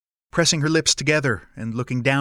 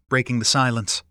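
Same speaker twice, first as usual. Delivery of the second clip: ordinary delivery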